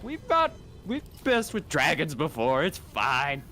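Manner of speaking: high-pitched breezy reassurance, easing down; mock-confident brushing off of danger; Harry. Imitating Harry reassuring Ron about dragons, breezy. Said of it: "And Harry's like".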